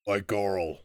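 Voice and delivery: loud, blunt troll; simple-minded troll stating his name. Acted. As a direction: grunt it out like a caged troll. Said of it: "Troll, I mean".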